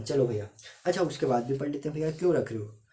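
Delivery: usual speaking voice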